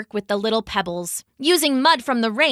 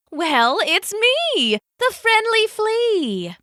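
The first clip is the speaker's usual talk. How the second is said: higher and slow, a cheerful little flea; happy piping voice; 11 semitones higher, slower than usual. Acted. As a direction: pipe it up brightly like a friendly saleswoman. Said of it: "piped a happy voice".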